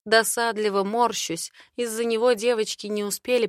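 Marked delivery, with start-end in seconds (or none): none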